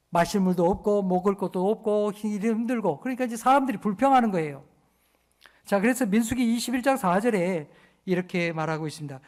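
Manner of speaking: normal talking voice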